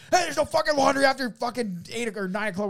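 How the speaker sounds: high-pitched retold shout; worked-up, indignant holler; angry yelling. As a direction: lay down the law at a noisy neighbour. Retold yelling at neighbour over laundry.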